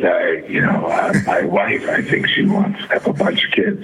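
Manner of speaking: raspy whispered Baldwin impression; Alec Baldwin raspy voice. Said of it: "he has that raspy voice".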